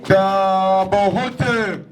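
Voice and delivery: drawn-out parade ground commands; drill commands. Acted. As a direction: call each order to the whole parade.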